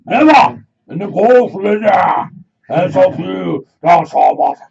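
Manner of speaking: booming hammed-up old English king; mock old king.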